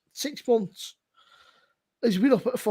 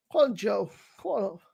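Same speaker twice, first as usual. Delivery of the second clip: ordinary talk, nothing marked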